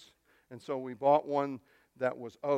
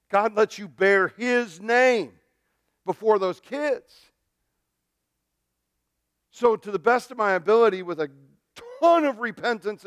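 emphatic preaching; 7 semitones higher, 8 dB louder than usual. Real, not acted. Preacher driving home a point.

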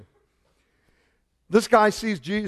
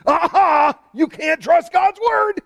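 high, loud mocking shout; a sneering critic crowing aha; mocking sceptic; 11 semitones higher, 4 dB louder, slower than usual. Preacher mocking critics with a high, loud gloat. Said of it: "they bring up the supposed thing".

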